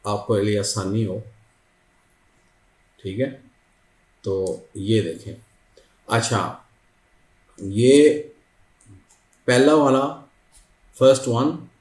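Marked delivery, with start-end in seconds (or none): none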